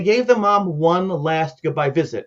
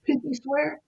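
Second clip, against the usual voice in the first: put-on high, soft little-girl question; child's hopeful question; 9 semitones higher, 5 dB quieter, slower than usual. Smiling sick girl asking caseworker to promise, soft high question. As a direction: ask softly and high, as a child would. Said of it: "she smiled and said".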